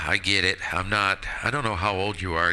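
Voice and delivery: normal talking voice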